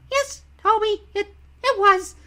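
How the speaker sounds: very high, squeaky cartoon engine voice; Percy. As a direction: stammer it out in a sky-high falsetto. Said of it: "Percy's line was".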